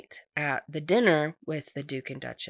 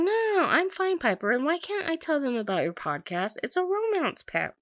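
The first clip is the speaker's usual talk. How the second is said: 1 chirpy mom; 11 semitones higher than usual. Retold or imitated. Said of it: "And she's like".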